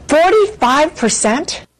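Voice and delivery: high, slow rising disbelief; genuinely stunned question; astonished. Real, not acted. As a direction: let the number hang in shock. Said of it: "she's astonished".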